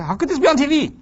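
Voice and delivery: high, loud shocked outburst; shocked viewer. Retold or imitated. Viewer reacting to gory TV, high shocked. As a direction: gasp out in disbelief. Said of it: "You say".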